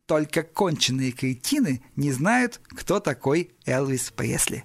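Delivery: slow, deliberate, word by word; savouring each word. Acted. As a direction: relish every word in vengeful triumph, slowly. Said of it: "смачно проговаривая каждое слово". (смачно проговаривая каждое слово)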